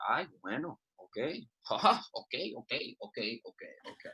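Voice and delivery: fading resigned mutter to nothing; grandma giving in. Retold or imitated. Grandma retreats from grandson's room, fading mutter. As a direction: mumble away as she leaves.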